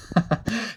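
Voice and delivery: short high-pitched laugh. Real, not acted. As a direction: burst out laughing. Laughs at own craziness.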